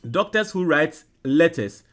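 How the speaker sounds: ordinary talk, nothing marked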